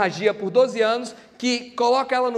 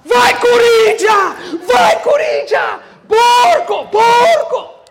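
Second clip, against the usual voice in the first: much higher, louder, stretched-out chant; football fan yelling; 12 semitones higher, 13 dB louder, much slower than usual. Retold or imitated. Preacher acting out a Corinthians fan at the Sunday match, yelling. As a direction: roar like a fan in the stands. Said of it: "chega o jogo do domingo".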